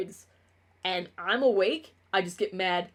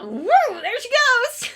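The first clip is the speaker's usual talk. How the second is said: much higher, louder, slow "Woo! There she goes!"; manic cheer; 14 semitones higher, 8 dB louder, slower than usual. Retold or imitated. Handing a manic person go-go juice, high cheer. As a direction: cheer someone off like a rocket.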